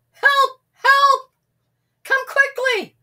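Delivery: high, loud crying yell; shouting. A narrator's reading. Sam shouting the wolf alarm again. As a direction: cry for help, panicked. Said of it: "he shouted".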